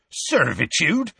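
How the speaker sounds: scathing. A narrator's reading. Hagrid echoing the grey centaur's word, scathing. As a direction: throw the word back with scorn. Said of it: "said Hagrid scathingly".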